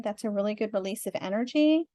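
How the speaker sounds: ordinary talk, nothing marked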